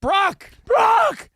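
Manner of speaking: shouting a name down the stairwell; calling out. Retold or imitated. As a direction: yell his name to get attention.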